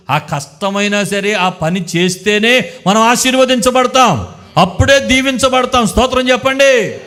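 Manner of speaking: loud, high, rousing sermon climax; full-force preaching. Acted. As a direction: preach it out to the whole church. (గట్టిగా, ఉత్సాహంగా ప్రసంగం)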